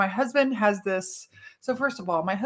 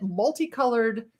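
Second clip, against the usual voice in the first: ordinary talk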